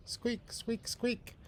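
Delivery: tiny squeaky mouse; mouse squeaking. Acted. Mouse answering the cat, tiny high squeaks.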